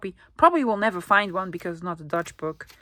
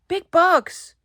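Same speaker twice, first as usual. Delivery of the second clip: delighted; 9 semitones higher, 8 dB louder than usual. Shown as a demonstration. Repeats phrase with growing delight.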